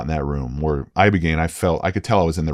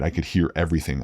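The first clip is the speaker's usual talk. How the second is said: ordinary delivery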